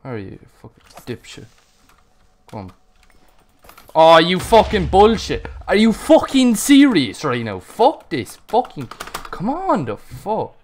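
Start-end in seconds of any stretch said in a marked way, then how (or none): 3.9-10.5 higher, much louder raging shout; furious yelling. Real, not acted. Gamer raging after dying yet again, furious shout. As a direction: scream it at the screen.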